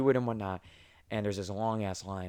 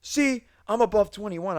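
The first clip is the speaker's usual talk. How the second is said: much higher, louder mock-angry voice; angry customer; 10 semitones higher, 4 dB louder than usual. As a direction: shove it in the cashier's face. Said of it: "He's like".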